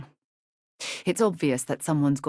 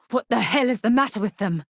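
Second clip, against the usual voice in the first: higher and louder, exasperated; angry outburst; 6 semitones higher, 5 dB louder than usual. Acted. Companion fuming about the bureaucratic cover-up, angry outburst. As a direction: let the outrage burst out.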